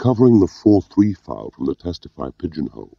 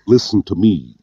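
sharp, raised command; 5 semitones higher, 5 dB louder than usual. A narrator's reading. Allaline cuts off Gwilym's excuses, sharp. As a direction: cut in to demand attention. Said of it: "said Allaline sharply".

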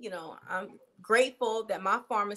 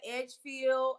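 ordinary delivery

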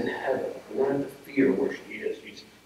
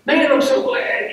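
mock gleeful exclamation; 13 semitones higher, 8 dB louder than usual.

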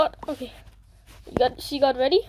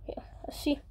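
whispering; 13 dB quieter than usual. Real, not acted. Waiting quietly to ambush.

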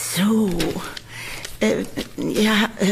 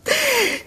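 a shrill-pitched little giggle, high up; 12 semitones higher than usual. Acted. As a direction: giggle with secret glee. Landlady's sinister delight. (hohes, heimliches Kichern)

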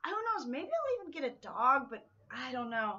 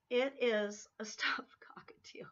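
slow, breathy whisper with a laugh; slower than usual. Real, not acted. Whispering the reveal of the stuffed cockatiel. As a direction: whisper it through a giggle.

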